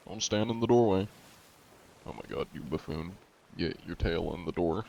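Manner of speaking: ordinary talk, nothing marked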